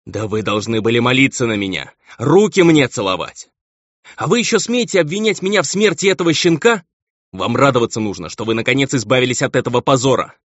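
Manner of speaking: high-pitched scornful tirade; outraged, haughty baron. A narrator's reading. Baron demands his wife be grateful, outraged. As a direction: demand gratitude, indignation pushing the pitch up.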